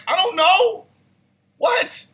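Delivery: high, loud yelp back at his wife; acted-out helpless squawk; exasperated reply. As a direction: throw it back high-pitched and baffled. His own exasperated reply, retold.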